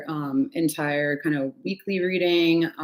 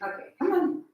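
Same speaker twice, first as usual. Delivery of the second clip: coaxing pet voice; 11 semitones higher, 5 dB quieter than usual. Real, not acted. Coaxing scared cat.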